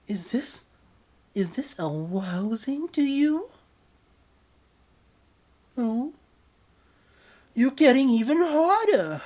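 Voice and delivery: put-on very high, loud babyish voice. Partner teases lover in baby voice, very high. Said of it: "acting like a baby".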